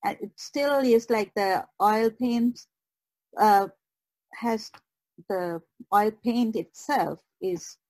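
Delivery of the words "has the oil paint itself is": normal talking voice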